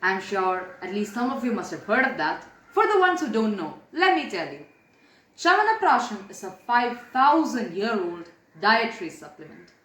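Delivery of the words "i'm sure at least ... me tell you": ordinary delivery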